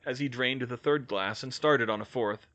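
ordinary delivery, nothing marked

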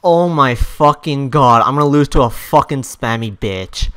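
loud, heated groaning complaint; angry disbelief. Real, not acted. Player about to lose to a spammer, angry disbelief. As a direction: complain loudly as defeat looms.

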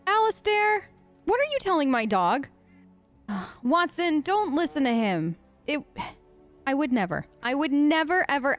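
ordinary talk, nothing marked

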